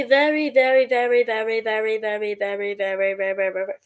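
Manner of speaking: rapid chain of "very" sinking in pitch; running on. Pretend. Streamer joking about spelling Lily, sinking chant. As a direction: let the repeated "very" wind steadily down.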